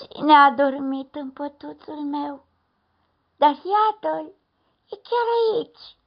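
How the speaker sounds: high, loud excited cub, rising to a squeal; little bear discovery. A narrator's reading. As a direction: exclaim it as the delighted cub. Baby bear finds the fox.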